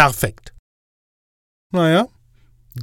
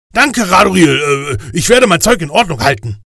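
higher, louder nervous voice; anxious Death; 6 semitones higher, 6 dB louder than usual. Acted. Death anxiously asking the inspector angel, higher and louder. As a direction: blurt it out, eager and nervous.

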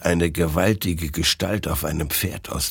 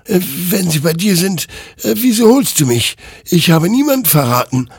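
high, louder protest with hesitations; defending himself; 12 semitones higher, 6 dB louder than usual. A narrator's reading. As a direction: push back against the accusation. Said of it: "wehrte sich Hektor".

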